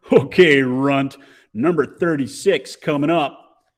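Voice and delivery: loud mocking pitcher; taunting bully. A narrator's reading. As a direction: jeer at the runt, loud. McNabb laughing, taunting the kid.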